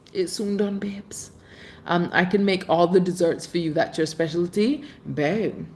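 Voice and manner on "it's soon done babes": a teasing secret whisper. Acted. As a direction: whisper it playfully, barely voiced. Same words repeated in a whisper.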